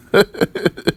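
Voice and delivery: high, airy chuckle; amused breathy laugh; real laugh. Real, not acted. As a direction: chuckle at the quote. Amused by the quote just read.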